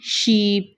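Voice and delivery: sheep, ee held for teaching; long ee in sheep. Shown as a demonstration. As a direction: stretch the ee of sheep. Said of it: "ये double ई की जो voice है".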